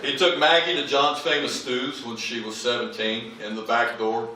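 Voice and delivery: ordinary delivery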